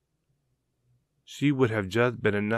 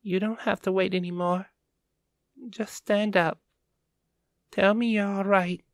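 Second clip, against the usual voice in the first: put-on high, pleading girl's voice; whimpering girl voice; 9 semitones higher than usual. A narrator's reading. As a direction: plead with her through tears. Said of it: "She whimpered".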